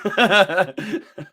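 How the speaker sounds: real, spontaneous laugh. Real, not acted. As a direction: laugh at your own emotional state. Laughs at own threat to cry.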